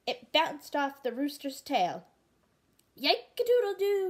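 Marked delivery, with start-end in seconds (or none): 2.9-4.1 high, loud rooster cry; rooster crow. Acted. Rooster hit by the ball, high loud crow. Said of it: "he cried".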